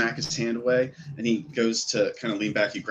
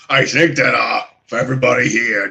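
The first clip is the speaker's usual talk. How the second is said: raised, loud call to the bar; hearty public holler; orc announcement; 4 semitones higher, 10 dB louder than usual. Acted. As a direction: proclaim it to the whole room.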